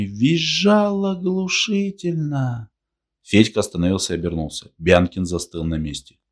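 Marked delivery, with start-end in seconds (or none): 0.0-2.7 slow, rising high drawn-out whine; squealing narration. A narrator's reading. Narrator tells of gravel betraying the old man, squealing. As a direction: climb high and stretch the last word.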